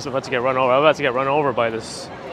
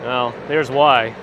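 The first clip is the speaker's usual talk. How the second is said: slow wry; 2 semitones lower, 4 dB louder, much slower than usual. Real, not acted. Coffee makes perky.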